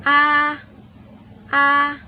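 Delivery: "A" held high and level, first tone. Shown as a demonstration. Teacher demonstrating first tone to viewers, high level "a". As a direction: keep "a" high and flat, steady pitch. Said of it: "第一声".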